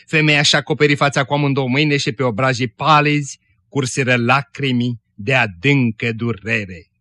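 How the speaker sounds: ordinary delivery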